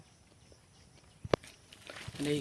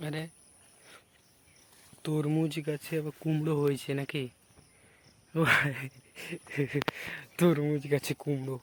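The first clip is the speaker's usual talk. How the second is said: surprised; 9 dB louder than usual. Real, not acted. Finds pumpkin on watermelon plant.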